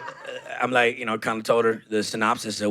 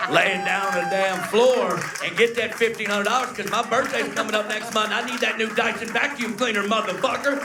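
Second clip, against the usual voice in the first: mom's rising rant; 8 semitones higher than usual. Retold or imitated. Mom ranting at her son, higher and higher toward a shout. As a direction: build mom's demand into a screechy peak.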